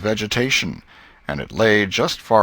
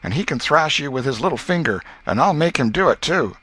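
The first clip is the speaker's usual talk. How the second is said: put-on boasting boy; 6 semitones higher than usual.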